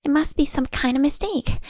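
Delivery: hysterical denial. Acted. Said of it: "deny everything hysterically".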